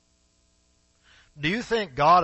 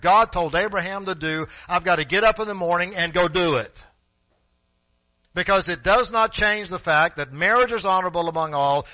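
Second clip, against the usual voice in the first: loud, driving sermon delivery; full-force preaching; 7 semitones higher, 9 dB louder than usual. Acted. Preacher pressing the congregation on marriage, loud and driving. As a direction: hammer it home to the church.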